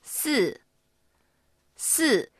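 四 starting high and falling fast. Shown as a demonstration. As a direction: plunge through 四 with spread lips. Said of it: "高いところから一気に下がってください". (「四」を高い所から一気に下げる)

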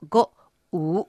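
う pitched low with a slight rise. Shown as a demonstration. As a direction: sink う below normal talking pitch. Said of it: "普段より低く発音します". (「う」を普段より低く)

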